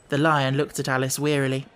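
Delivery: plain reading voice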